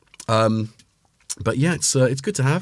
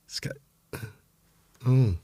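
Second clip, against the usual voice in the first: ordinary delivery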